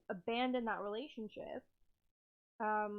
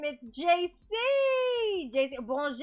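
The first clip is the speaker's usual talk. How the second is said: much louder, very slow, thrilled; excited; 5 semitones higher, 12 dB louder, much slower than usual. Real, not acted. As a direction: call out in delight. Streamer greets a viewer.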